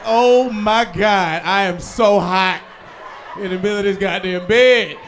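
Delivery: a hint of a whining kid, drawn out slow; whiny hot kid. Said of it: "Just be like".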